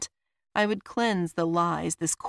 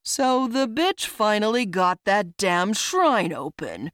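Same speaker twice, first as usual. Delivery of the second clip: louder, gloating villain; changed villain voice; 3 semitones higher, 5 dB louder than usual. A narrator's reading. Roslo reveals himself with devilish smirk, voice changed. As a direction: drop the mask, voice transformed. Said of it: "sound of his voice ... completely changed".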